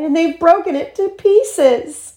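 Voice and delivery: high-pitched little cub voice; Baby Bear crying out. A narrator's reading. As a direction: wail it as a tiny bear. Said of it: "he cried".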